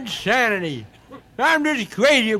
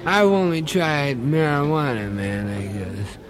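stoned hippie voice; 9 semitones lower than usual. Acted.

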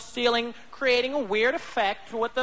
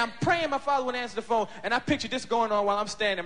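usual speaking voice

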